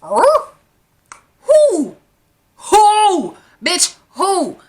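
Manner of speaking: offended, rising repeated who; incredulous who. Real, not acted. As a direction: shoot back who like she can't believe it. Outraged at "you really liked me.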